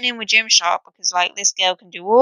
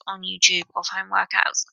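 whispered aside; 3 semitones lower, 6 dB quieter than usual. Real, not acted. Aside in podcast.

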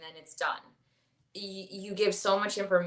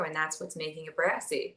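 ordinary talk

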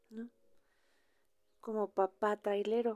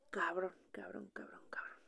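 whispered swearing; 2 semitones lower, 8 dB quieter than usual. Retold or imitated. Swearing under breath.

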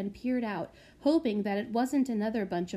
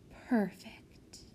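whisper; 6 dB quieter than usual. Acted. Sneaking prodigy alone.

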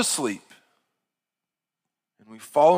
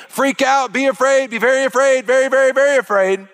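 mock panic; 10 semitones higher, 7 dB louder than usual. Mocking fear-mongering media voice.

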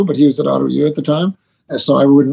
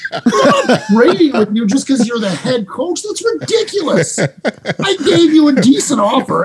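much higher, half-laughing mock outrage; pissed-off player; 9 semitones higher than usual. Player barging into coach's office over a fantasy trade, mock outraged. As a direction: play the furious player storming in, laughing through it. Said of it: "Oh, he's pissed".

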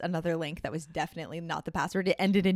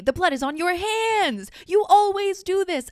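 hammed-up melodrama; 13 semitones higher, 9 dB louder, slower than usual. Melodramatic guilt-trip text to scammer.

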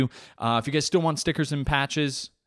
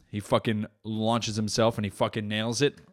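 ordinary delivery

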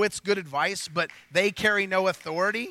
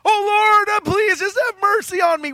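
mock pleading cry to God, raised high; begging prayer; 9 semitones higher, 5 dB louder than usual. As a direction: plead like a desperate beggar. Begging prayer voiced in sermon.

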